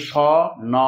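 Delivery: স and ন as two open sounds with a pause between; letters wrongly separated. Shown as a demonstration. Teacher shows what we don't say, স and ন apart. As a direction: split শন into স, then ন. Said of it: "বলি না".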